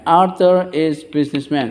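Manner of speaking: measured classroom drill; repeat-after-me model. Shown as a demonstration. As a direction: say each word separately for the class to repeat. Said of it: "Coba diulang ya".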